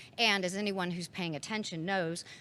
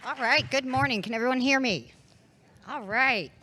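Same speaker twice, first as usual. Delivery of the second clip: projecting loudly to a room; loud, carrying public greeting; in front of an audience; 6 semitones higher, 9 dB louder than usual. Real, not acted. Opening address to live audience.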